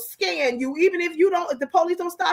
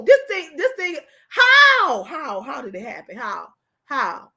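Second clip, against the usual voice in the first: real exasperated shout. Real, not acted. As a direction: shout it in disbelief, stretching the last word. Outraged at unnoticed missing children.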